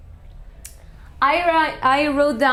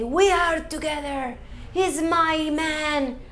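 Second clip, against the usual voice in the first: put-on higher, possessive girlfriend voice; jealous woman; 5 semitones higher than usual. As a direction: claim your man in front of everyone. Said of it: "if I'm a jealous woman".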